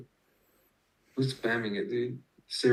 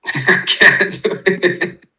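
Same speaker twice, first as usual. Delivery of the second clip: laughing; 9 semitones higher, 12 dB louder, much slower than usual. Real, not acted. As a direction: burst out laughing, loud and high. Cracks up at own accent attempt.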